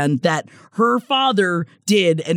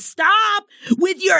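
high, slow, mock-furious cry of stop; hammed-up angry shriek; shrill mock outburst; 11 semitones higher, slower than usual. Kelly snapping at the Spanish-speaking moms, mock outburst. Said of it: "she's like".

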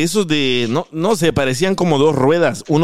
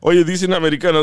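normal talking voice

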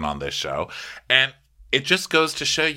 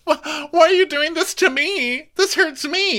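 very high mock self-pity; mocking Kyle; 4 dB louder than usual. Host mocking Kyle's self-pity in a high whine. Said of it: "you're just like".

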